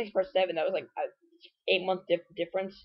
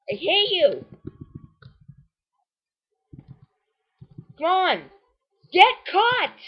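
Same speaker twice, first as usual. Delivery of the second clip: high-pitched frustrated outburst; strained, rising demand; exasperated pleading; 10 semitones higher, 3 dB louder, slower than usual. Real, not acted. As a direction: cry it out at the game, desperate. Urging the ball to catch it.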